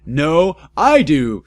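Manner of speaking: high, loud shout. A narrator's reading. Rival claiming the girl, loud shout. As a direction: shout back hard.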